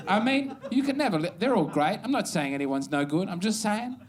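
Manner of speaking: normal talking voice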